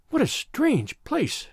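high, slow woman's wonder. A narrator's reading. Woman character looks round in wonder.

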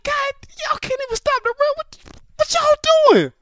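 piercing high-pitched outburst; high upset voice. Retold or imitated. Upset player yelling at teammates, very high-pitched. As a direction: re-enact yourself upset, voice way up high. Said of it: "I talk real high".

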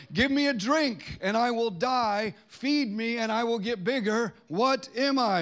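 high, loud, drawn-out riddle; riddle for children. Shown as a demonstration. Church host poses a riddle to kids, loud and deliberate. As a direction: pose the riddle big and slow.

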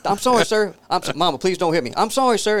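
high, hurried begging; mock frightened pleading. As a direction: imitate a grown son pleading with his mama. Voices son begging mama and officer.

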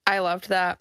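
ordinary talk

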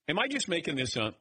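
ordinary talk, nothing marked

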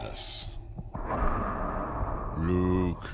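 deep, heavy villain voice through a helmet; menacing masked villain line; Darth Vader voice. Retold or imitated. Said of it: "We got Darth Vader breathing".

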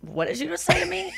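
hushed, high-pitched disbelief; baffled, rising question; mock incredulous. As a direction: ask it softly, pitch climbing in disbelief. Imagined baffled reply to misheard words.

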